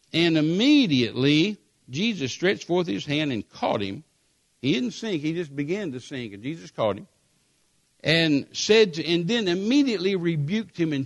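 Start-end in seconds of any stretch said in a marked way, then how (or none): none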